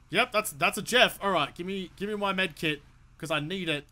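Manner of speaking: ordinary delivery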